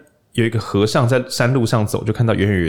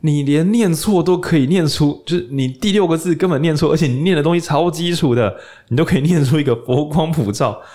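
higher, stunned exclamation of wonder; amazed monk; 6 semitones higher than usual. Retold or imitated. Monk amazed by the old woman's wrong chant, awestruck. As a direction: gasp it out like a dazzled monk. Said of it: "然后和尚一听想说".

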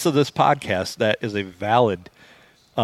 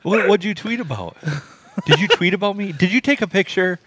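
mock suspicious woman; 7 semitones higher than usual. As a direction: pitch it up like a suspicious date grilling him. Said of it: "pick up their phone and go".

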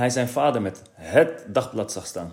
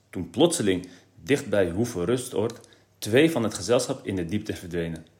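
ordinary delivery

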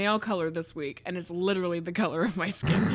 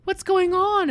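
much higher, alarmed family voice; worried high voice; 12 semitones higher, 5 dB louder than usual. Acted. Deer family around injured father, worried high voice. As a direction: fret loudly about what happened.

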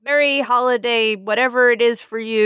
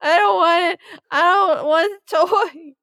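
put-on whimpering child; 9 semitones higher than usual. Scared kid refusing puppet.

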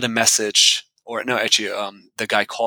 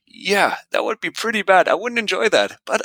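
higher, gentle inner pep talk; a hint of a self-reassuring thought; lightly voiced reassuring self-talk; 8 semitones higher than usual. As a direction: talk yourself into calm, lightly.

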